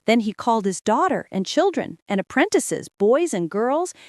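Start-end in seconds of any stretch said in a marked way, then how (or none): none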